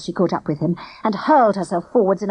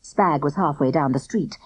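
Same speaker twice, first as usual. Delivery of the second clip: plain reading voice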